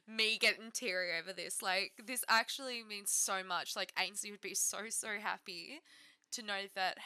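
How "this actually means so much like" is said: ordinary delivery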